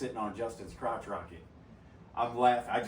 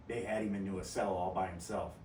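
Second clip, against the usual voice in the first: normal talking voice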